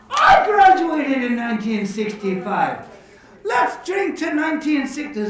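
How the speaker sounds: loud, hearty joke-character exclamation; hammed-up drinker's delight; amazed echo. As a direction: burst out at the coincidence, then toast. Joke character amazed at same year.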